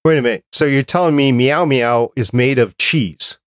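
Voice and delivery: ordinary talk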